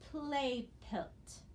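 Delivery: teacher reading Play pilt aloud; reading the word. Shown as a demonstration. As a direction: Play pilt, rising high on play. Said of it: "then we need to read the word".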